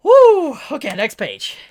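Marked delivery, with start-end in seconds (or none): 0.0-0.6 high, loud "woo" of release; loud whoop. Real, not acted. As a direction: let out a sharp whoop to shake off emotion. Releasing emotion before next page.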